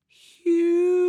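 high, eerie forest spirit call; a ghostly voice from the mist. Acted. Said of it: "he heard the voice say".

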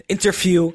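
"interview" repeated high and emphatic after a slip; self-corrected INTERVIEW. Real, not acted. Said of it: "I'm letting my Dutch accent come out".

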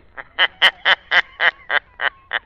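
long mocking laugh from nowhere; eerie Shadow laugh. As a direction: laugh like an unseen phantom. Unseen Shadow mocks Fred.

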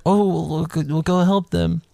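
high-pitched old lady impression; imitating an old lady offering help. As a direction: put on an old lady's high voice. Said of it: "And so she's like".

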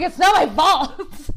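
laughing it's-not-my-fault protest; defensive. Retold or imitated. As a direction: plead innocence through a laugh. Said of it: "I'm like".

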